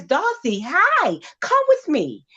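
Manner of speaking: put-on high, friendly child-like invitation; little girl. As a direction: voice a little girl beckoning a playmate. Story: little girl invites Dorothy.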